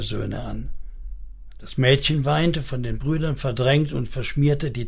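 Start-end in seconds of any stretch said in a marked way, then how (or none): none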